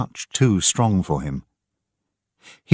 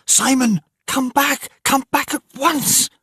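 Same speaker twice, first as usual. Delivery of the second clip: put-on high frantic scream calling the child back; a mother shrieking in panic; much higher screamed call to a runaway child; woman screaming. A narrator's reading. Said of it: "and a woman screamed".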